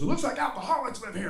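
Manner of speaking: high-pitched exasperated dad; hammed-up high complaint; mock dad outburst. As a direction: imitate a dad's random angry announcement. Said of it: "he goes".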